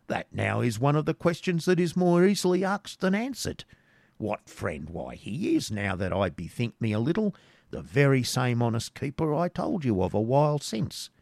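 high, climbing pitch as he stalls; evasive hermit. Acted. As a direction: stall for time as a wily hermit, pitch swooping.

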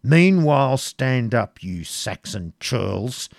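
loud, drawn-out imperious command; fiery prince. A narrator's reading. Prince ordering the Saxons to stand, fiery and slow. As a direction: order them up with fire. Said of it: "said the fiery prince".

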